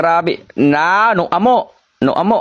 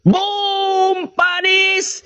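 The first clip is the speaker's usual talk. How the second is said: triumphant cheer; 8 semitones higher, slower than usual. Real, not acted. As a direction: crow over the win. Victory cheer after winning.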